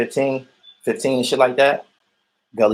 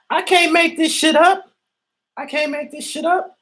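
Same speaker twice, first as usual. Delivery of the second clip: much higher, squeaky disbelief on "I can't make this shit up"; incredulous squeal. Real, not acted. Repeated in disbelief.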